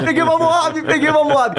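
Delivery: high excited gamer brag. Retold or imitated. Retelling excited shout to mom.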